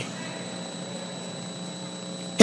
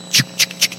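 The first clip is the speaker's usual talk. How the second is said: pasos en hojas susurrados; 4 dB quieter than usual. Retold or imitated. Imita pasos en las hojas.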